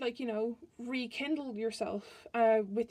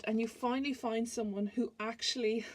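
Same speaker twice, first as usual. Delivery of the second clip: usual speaking voice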